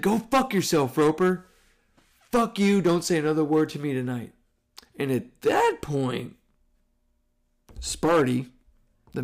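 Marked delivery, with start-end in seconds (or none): 0.0-4.3 higher, louder angry outburst; retold yell. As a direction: yell back across the room in a rage. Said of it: "I just yelled across the room".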